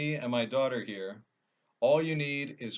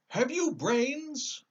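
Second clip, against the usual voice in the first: very high, slow scarecrow question; a straw man asking curiously; squeaky-high scarecrow asking; 5 dB louder, much slower than usual. Acted.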